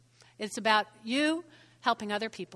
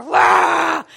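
loud, drawn-out roar; higher burst of mock exertion; pumped-up roar; 4 semitones higher, 11 dB louder than usual. Preacher showing Nehemiah working harder and stronger, roaring.

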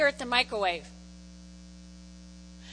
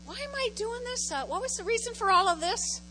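high, soft wondering voice; mock puzzled self-questioning; acted bewildered questioning; 7 semitones higher, 4 dB quieter than usual. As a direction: wonder it aloud in a raised pitch. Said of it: "you take a deep breath, and you go".

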